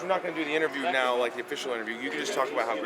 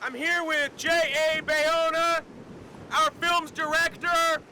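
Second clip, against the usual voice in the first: high, full-voiced shouted introduction; shouting over wind; slower than usual. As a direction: yell it out as if over a roaring wind.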